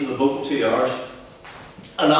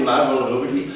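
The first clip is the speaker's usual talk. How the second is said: ordinary delivery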